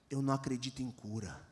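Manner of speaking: lightly voiced doubting teen; defiant teen. Skeptical teen challenging the preacher, low and soft.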